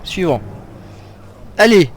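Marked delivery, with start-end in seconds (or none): 1.6-1.9 short, loud battle calls; urging shouts. Real, not acted. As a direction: spur them on loudly. Urging on during fight.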